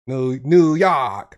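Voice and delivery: New York, loud and drawn out in a New York accent; attempted New York accent. Shown as a demonstration. Southerner trying to say it like a New Yorker, New York accent. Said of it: "I can't do it".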